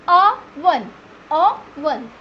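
Oven split in two beats, "UH - vun"; correct pronunciation. Shown as a demonstration. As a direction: say Oven syllable by syllable with an open "uh". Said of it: "Correct pronunciation is". (Oven को "अ-वन" तोड़कर बोलें)

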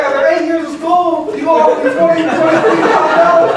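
high, loud, laughing disbelief; can't believe the offer; incredulous. Retold or imitated. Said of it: "I was like".

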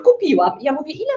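ordinary talk, nothing marked

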